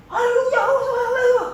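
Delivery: high, thin call away from the mic; faint haleluya off-mic; unamplified demo. Shown as a demonstration. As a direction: sing it out without the mic's help. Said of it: "Nggak kedengeran".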